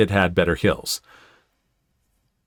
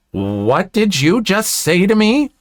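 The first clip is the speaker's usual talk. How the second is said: higher, slow affronted question; 7 semitones higher than usual. A narrator's reading. Entitled mother answering an insult, affronted. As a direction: draw it out as an offended entitled mother.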